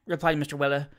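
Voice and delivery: ordinary delivery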